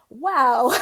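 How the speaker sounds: loud, high laughing wow; a stunned wow that breaks into a laugh. Retold or imitated. Reacting to being sworn at.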